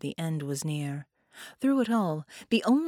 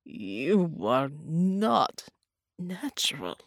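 very slow croak dying into a whisper; croaking, fading to whisper; much slower than usual. Acted. Dying man to captor, croak fading to whisper. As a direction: drag each word out, then lose the voice. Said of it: "Bela croaked".

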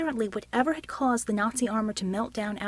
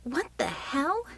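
higher, drawn-out disbelieving question; shocked disbelief; 6 semitones higher, slower than usual. A narrator's reading. Woman gaping at the remains, shocked. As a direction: draw it out, rising in disbelief.

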